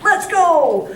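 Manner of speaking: a giddy dog begging for dinner; laughing as the eager dog. Pretend. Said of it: "my dog goes".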